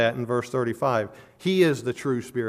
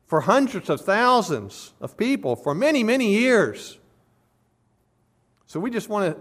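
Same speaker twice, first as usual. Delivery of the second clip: higher and louder, a mildly scoffing crowd voice; mocking crowd demand; 7 semitones higher, 4 dB louder than usual. Crowd challenging Jesus to beat Moses, scoffing and higher. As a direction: play the crowd daring Jesus to top Moses. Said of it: "they say".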